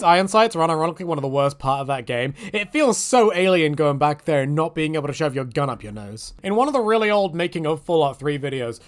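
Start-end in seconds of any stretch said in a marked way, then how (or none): none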